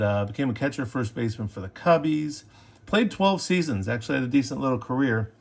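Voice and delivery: usual speaking voice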